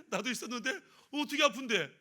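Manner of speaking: laughing through the question; laughing robber. Acted. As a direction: chuckle while asking where it hurts. Robber laughs at shared pain.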